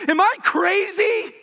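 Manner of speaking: panicked cry. Retold or imitated. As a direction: imitate the nervous pastor cracking at the end. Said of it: "his voice starts going rampant".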